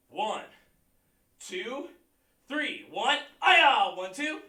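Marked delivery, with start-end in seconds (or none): 3.4-3.9 sharp, loud martial arts shout; loud kiai. Shown as a demonstration. Instructor leads the class through the form: loud kiai. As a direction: snap the shout out with the punch. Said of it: "you key up".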